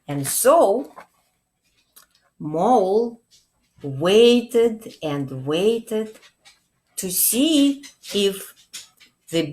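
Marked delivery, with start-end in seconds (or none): none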